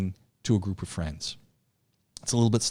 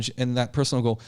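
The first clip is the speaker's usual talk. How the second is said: ordinary delivery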